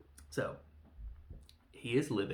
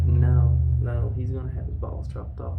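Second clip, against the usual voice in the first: much louder urgent correction; emphatic shout; 13 dB louder than usual. Retold or imitated. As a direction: shout him down. Correcting Taylor's misunderstanding.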